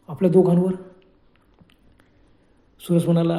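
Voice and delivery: plain reading voice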